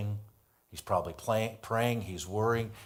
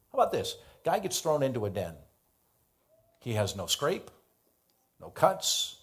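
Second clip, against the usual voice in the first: ordinary delivery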